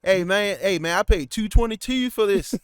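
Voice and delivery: loud, high hammed-up complaint; hammed-up angry ticket buyer yelling; mock angry moviegoer. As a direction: play an outraged moviegoer who paid.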